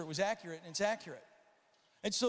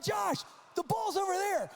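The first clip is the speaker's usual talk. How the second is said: calling out; 13 semitones higher than usual. Retold or imitated. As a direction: holler to direct him. Calling out on field.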